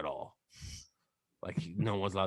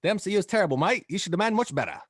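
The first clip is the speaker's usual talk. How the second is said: higher, louder Australian accent with added "mate"; 9 semitones higher, 5 dB louder, faster than usual. Shown as a demonstration. Demonstrating to his co-hosts how to do it, Australian accent. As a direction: show them how an Aussie says it, mate tacked on. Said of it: "start like this, mate".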